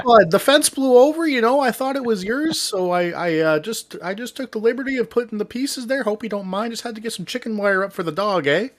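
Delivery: Canadian guy impression. Neighbor explaining he moved the pieces, high Canadian accent. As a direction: play the easygoing Canadian neighbor. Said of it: "good, good old Canadian guy".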